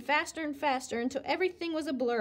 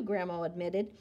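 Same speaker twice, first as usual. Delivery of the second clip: usual speaking voice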